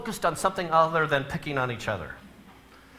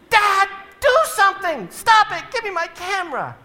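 put-on high, loud panicked girl shriek; shrieking daughter; 12 semitones higher, 5 dB louder than usual. Daughter in back seat shrieking at her brother, high and loud. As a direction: shriek for Dad to make him stop.

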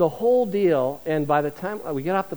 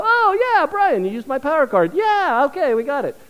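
much higher, bright oh yeah Brian; relieved excitement; 8 semitones higher, 5 dB louder than usual. Retold or imitated. Pastor recognising the worship leader on the plane, relieved and excited. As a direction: gush with relief that he knows him.

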